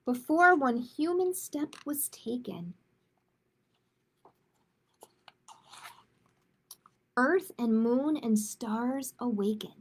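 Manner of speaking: lilting, sing-song storybook narration; picture book reading. A narrator's reading. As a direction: read the rhyme aloud to children, gently. Reads a rhyming picture book.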